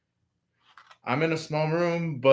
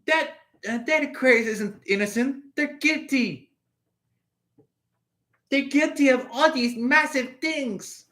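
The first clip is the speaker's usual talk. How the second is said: higher mock accuser voice, pointing fingers; accusing third parties; 9 semitones higher than usual. Third parties wrongly calling Aquarius guilty, higher accusing voice. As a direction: play the blaming outsiders, higher and righteous. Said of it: "these third parties are like".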